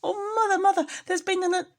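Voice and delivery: very high, loud crying out; a scared kitten tumbling from the jar; high squeaky cry; frightened kitten. Acted. Frightened kitten reporting rat.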